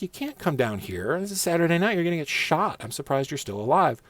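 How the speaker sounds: mild mock lecture from a patrol cop; lecturing cop. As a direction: cop warning a stranger off the street.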